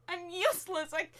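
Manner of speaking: ordinary delivery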